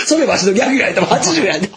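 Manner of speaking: put-on high-pitched, laughing retelling of an old comic; imitated veteran comedian. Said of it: "谷さんが". (笑いながら真似るベテラン芸人)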